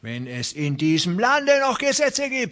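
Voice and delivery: hope against hope; indignant rancher. Acted.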